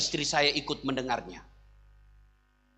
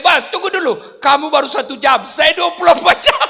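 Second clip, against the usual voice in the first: laughing husband; 9 semitones higher, 9 dB louder than usual. Retold or imitated. Husband jokes to jinn.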